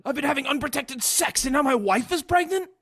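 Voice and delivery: high, stunned mock disbelief; hammed-up stunned outrage, pitched high; blindsided Shrek. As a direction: play Shrek hearing shocking news. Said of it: "Shrek is absolutely blindsided".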